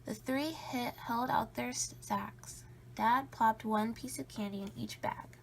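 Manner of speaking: ordinary delivery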